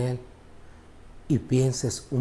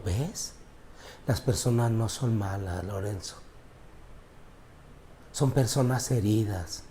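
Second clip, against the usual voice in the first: gentle voice of God; 3 semitones lower, 8 dB quieter than usual. Retold or imitated. God speaking gently to him.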